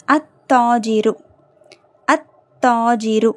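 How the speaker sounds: slow "அத்தாஜிரு", L dropped into a doubled T; teacher's drawn-out "அத்தாஜிரு" with silent L; lam silent. Shown as a demonstration. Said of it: "லாம் உச்சரிக்கப்படலை".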